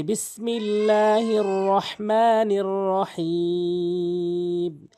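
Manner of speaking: slow, drawn-out chanted recitation; Quran recitation. Acted. Teacher opening the lesson, slow chanted recitation. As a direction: intone it slowly like tilawat.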